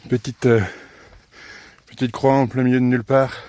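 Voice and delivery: out of breath from the climb; puffing hard between words; blowing out hard. Real, not acted. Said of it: "si vous m'entendez souffler".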